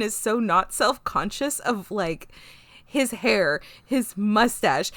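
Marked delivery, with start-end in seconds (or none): none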